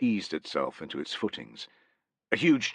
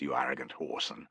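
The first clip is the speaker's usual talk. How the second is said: soft, lower private thought; inner thought; 7 semitones lower, 8 dB quieter, faster than usual. Acted. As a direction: say it under your breath to yourself. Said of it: "thought Tullus".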